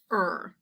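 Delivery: "er" sounded alone as one r-colored vowel; isolated vowel sound. Shown as a demonstration. Teacher pulling the sound out of nervous, "er" sounded alone. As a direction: give "er" as a single sound. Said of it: "Er sound with E R".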